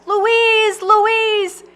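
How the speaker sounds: high, loud call of her name; shouted name, high. A narrator's reading. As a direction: call after her desperately. Farley calls back to her.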